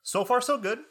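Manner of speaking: high, careful chirp; cautious cheer. Retold or imitated. Student avoiding jinxing the semester, high guarded chirp. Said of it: "now I just walk around like".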